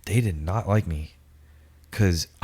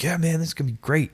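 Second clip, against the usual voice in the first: higher, quick gullible enthusiasm; eager; 7 semitones higher, faster than usual. Retold or imitated. Gullible recruit agreeing to the recruiter, eager. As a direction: re-enact your younger self's eager yes.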